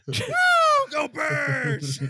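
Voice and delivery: high, loud sports cheer; mock cheer. Fan cheering the Birds in a loud high shout. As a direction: whoop it like a stadium fan.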